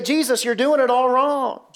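high-pitched pretend protest; hammed-up indignant correction; mock objection. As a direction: exclaim it in mock disbelief at a blunder. Acts people objecting to Jesus' strategy.

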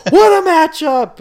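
excited announcer roar, high and drawn out; Vince. Acted. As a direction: belt it like an overexcited commentator. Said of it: "the announcers are getting excited here. Vince is like".

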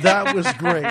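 loud, sarcastic mock praise; mock sarcastic outburst. Host acts out wife berating Henry, loud mock sarcasm. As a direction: throw out fake praise loudly, amused. Acting out wife's scathing sarcasm.

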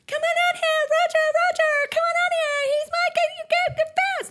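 very high, loud squeaky mock Brianna voice; squeaky Brianna impression. Host mimicking Brianna calling her husband outside, very high and loud. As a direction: squeal it out like an excited young mother. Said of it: "you hear Brianna go".

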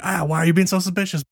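high, quick mock protest; arguing. Friends argue over Will being suspicious, accusingly. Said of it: "arguing about".

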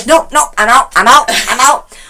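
high, loud repeated bail-out; mock panicked refusal. Imitating mom fleeing the car, mock panic. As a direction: blurt it out as you flee.

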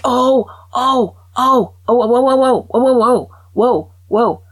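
loud, high, overexcited whoa whoa whoa; excited whoas. Real, not acted. Bursting in to tell the audience her news, loud high excited whoas. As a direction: burst in bubbling over with excitement.

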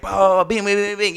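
mock frantic bing bing; frantic sister. Stressed sister mocked by her brother, frantic. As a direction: imitate a stressed sister going crazy. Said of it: "you know, going all crazy".

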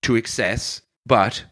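put-on storytelling in a broad Irish accent, rolling along. Irish bar owner telling his funeral story in an Irish accent. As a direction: spin the yarn like an Irish pub teller.

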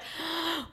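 sharp gasp. Retold or imitated. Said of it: "I was like".

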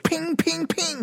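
very high ringing pings; imitated ping. Coins hitting the singer, high imitated pings. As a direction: mimic coins bouncing off, quick and high. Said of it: "hitting off of there".

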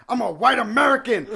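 high loud outraged claim; mock indignant yell. Customer shouting his protest at the cashier. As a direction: yell it as your trump card.

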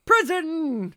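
high, loud hammy decree; mock sentence shout. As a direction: bark it out like a mad judge.

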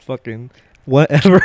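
normal talking voice

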